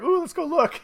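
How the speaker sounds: high-pitched, playful mock curiosity; nosy old ladies. Quoting old ladies peeking at a couple, nosily curious. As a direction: lightly imitate eager snooping old ladies. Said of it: "these horny old ladies".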